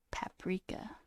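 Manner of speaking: breathy whisper of Paprika. Real, not acted. Whispering paprika to herself while wondering how it's said. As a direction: softly whisper the word Paprika.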